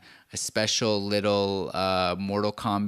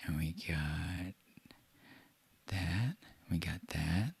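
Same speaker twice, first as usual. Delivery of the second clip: whisper; 6 semitones lower, 14 dB quieter than usual. Real, not acted. Muttering while setting up.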